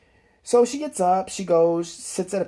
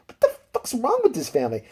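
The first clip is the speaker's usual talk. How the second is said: high-pitched imitated outburst; mock outraged girl; 9 semitones higher than usual. Girl fighting her mother, high mock outrage. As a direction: exclaim it as an exasperated daughter. Daughter reacts during the fight.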